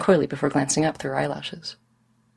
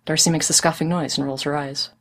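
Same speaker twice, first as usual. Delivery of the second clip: usual speaking voice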